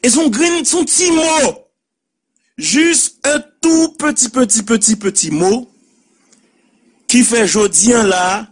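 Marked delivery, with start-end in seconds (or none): 0.6-5.7 high, slow drawn petit, petit, petit; tiny word stressed. Shown as a demonstration. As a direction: stretch the petit again and again for effect. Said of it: "Deux syllabes".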